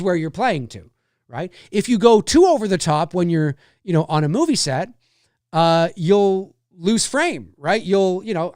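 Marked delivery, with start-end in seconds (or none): none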